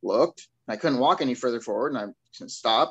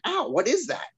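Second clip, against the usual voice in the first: startled ow; 10 semitones higher than usual. Retold or imitated. Woken by rocks hitting his head.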